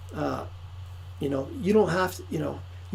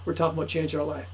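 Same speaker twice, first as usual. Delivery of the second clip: ordinary talk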